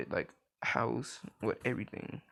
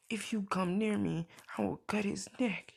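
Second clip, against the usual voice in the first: mother's threat; 8 semitones higher than usual. Retold or imitated. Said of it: "and, like, says".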